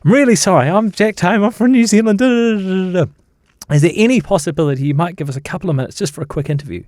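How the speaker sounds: high, apologetic gabble, retold; nervous introduction. Journalist introduces himself to Sir David, mock-flustered and high. Acted nervous approach to Attenborough.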